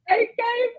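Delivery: very high, tearful blubber; dramatic mock crying. Emotional guest acting out her tearful account, mock crying. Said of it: "I'll be so dramatic".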